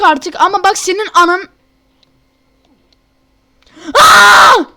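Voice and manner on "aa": sharp loud startled yelp; startled scream. Real, not acted. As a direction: cry out in sudden alarm. Startled yell during climb.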